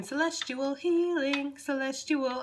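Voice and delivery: a light, slow otherworldly voice; voicing the spirits. Reader voicing Spirit's message, lightly ethereal. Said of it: "They're saying".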